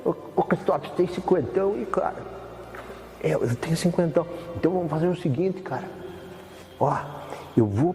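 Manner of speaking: lightly put-on voice of a pal with a jutting jaw, asking about cash; imitating his friend. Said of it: "até eu consigo imitar ele".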